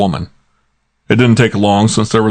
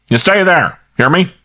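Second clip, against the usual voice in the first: much higher barked order; bossy inspector; 11 semitones higher, 3 dB louder than usual. Acted. Kramer orders Archie over the phone to stay. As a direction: command him like an angry cop.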